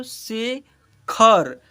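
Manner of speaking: Shekhar drawn out very slowly, syllable by syllable; stretched-out word. Shown as a demonstration. Teacher to children reading a word, very slow. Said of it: "यहाँ पर क्या लिखा हुआ है".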